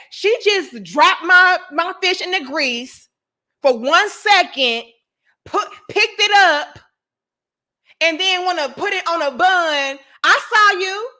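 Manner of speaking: indignant, emphatic mock rant; customer going off. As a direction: imitate an outraged complainer laying out the charge. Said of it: "This bitch went off".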